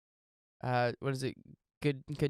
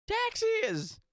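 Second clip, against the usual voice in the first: very high put-on voice; high silly voice; high joke voice; slower than usual. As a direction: squeak it out for a laugh.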